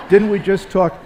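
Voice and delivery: high, loud, laughing through the line; laughing repeat. Real, not acted. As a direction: relive the gaffe with a laugh. Repeating his own retold line.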